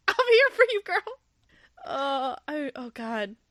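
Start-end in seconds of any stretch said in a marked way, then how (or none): 0.1-1.2 very high, loud, squealing support; fangirl cheer. Real, not acted. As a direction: squeal like a cheering best friend. Cheering on the couple.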